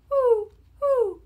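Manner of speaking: high falling hoots; owl hoots. A narrator's reading. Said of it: "He can sound like an owl".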